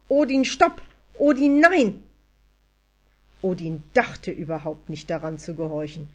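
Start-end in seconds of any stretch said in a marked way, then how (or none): 0.1-2.0 loud, high yelling at a dog; roared command. A narrator's reading. Anja orders Odin off the table, roaring. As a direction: shout desperately to stop him. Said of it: "brüllte sie".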